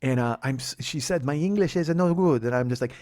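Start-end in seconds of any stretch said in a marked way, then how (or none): 1.2-2.4 higher mock Italian accent. Passenger admits poor English, mock Italian accent. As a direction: put on broken English with an Italian lilt. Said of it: "she has an Italian accent".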